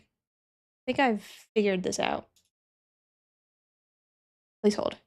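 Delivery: usual speaking voice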